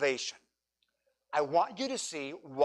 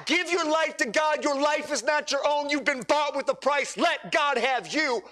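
high, ringing preacher's call; impassioned sermon climax; fervent preaching; 7 semitones higher than usual. Acted. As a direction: plead with the whole room to give their lives. Preaching at full force.

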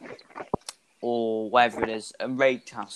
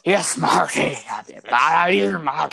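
louder hammed-up pirate; mock pirate voice; 3 semitones higher, 4 dB louder than usual. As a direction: play a loud pirate mocking. GM mimics pirate talk.

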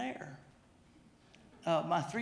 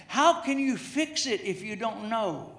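normal talking voice

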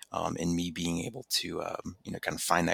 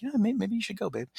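wife's voice. Retold or imitated. Wife voiced in story.

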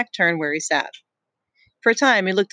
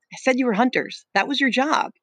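put-on a boy's higher protesting voice; higher, urgent boy insisting; higher, pleading Ralph; 7 semitones higher than usual. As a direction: push back, voice up high. Ralph defends himself.

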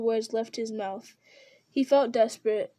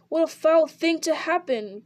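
raised, frantic outburst; wild cry; 5 semitones higher, 4 dB louder than usual. A narrator's reading. Frodo cries out wildly as Merry is trapped. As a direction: cry out in panic at the tree. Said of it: "cried Frodo wildly".

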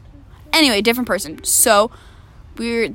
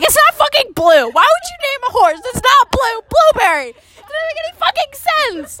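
high-pitched exasperated protest; indignant ranting; 12 semitones higher than usual. Retold or imitated. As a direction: rant in disbelief, pitched way up. Child's indignant reaction, retold.

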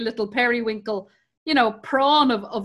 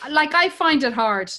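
ordinary delivery

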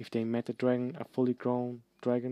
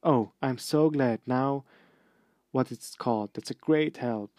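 snarling sarcasm; 6 dB louder than usual. Acted. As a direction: sneer it while struggling. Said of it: "snarled Ron".

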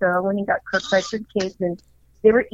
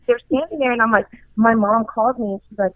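usual speaking voice

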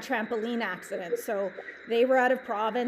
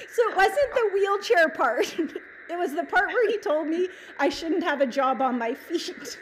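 laughing through the words; can't stop laughing while explaining it; real laughter; 6 semitones higher than usual. Real, not acted. Laughing as she tells the host about her lost hairdresser dream.

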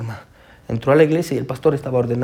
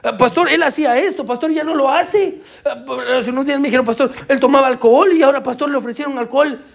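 higher, eager testimonies with a laugh; excited church member; 8 semitones higher than usual. Retold or imitated. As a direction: tell the pastor the good news eagerly. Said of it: "empezaron a cambiar su vida y decían". (miembros de la iglesia cuentan al pastor, animados)

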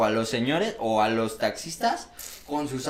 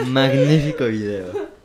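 mock grand announcer; 4 dB louder, slower than usual. Mock grand praise, slowed.

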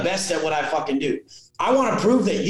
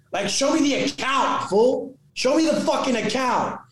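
demanding; 7 semitones higher than usual. Retold or imitated. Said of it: "I'm like".

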